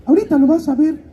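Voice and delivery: very high put-on voice of a godmother. Godmother teasing her godson about what's coming, in a high put-on voice. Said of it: "le digo, "Oye, madrina".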